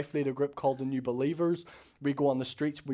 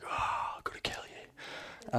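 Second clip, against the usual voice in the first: hushed, breathy mock threat under the breath; whispered threat; 9 dB quieter, slower than usual. Telling the gloating officer he'd kill him, in a whisper. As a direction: whisper it like a secret menace. Unvoiced reply to mocking officer.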